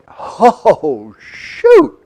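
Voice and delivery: drawn-out "Oh, shoot" soaring high and loud; hammed-up dismay at a hard diet; exasperated groan. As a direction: groan it out in mock despair.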